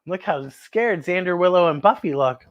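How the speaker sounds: ordinary delivery, nothing marked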